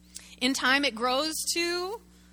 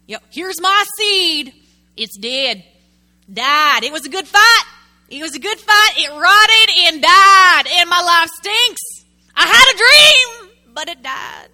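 loud, high mock lament over a dead seed; self-pitying believer; 6 semitones higher, 16 dB louder, slower than usual. Preacher mocking a churchgoer who shows off his failure, loud mock self-pity. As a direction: ham up the brave martyr showing off his failure.

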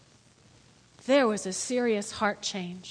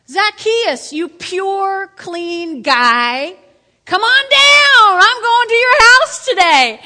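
much higher and louder, slow calling out; Jesus calling out; 8 semitones higher, 14 dB louder, slower than usual. Acted. Jesus calling Zacchaeus down from the tree, high loud call. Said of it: "So Jesus says".

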